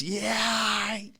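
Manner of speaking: high, drawn-out catchphrase holler; mock yell. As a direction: imitate a yelled "all right" in Queens style. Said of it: "just yell that".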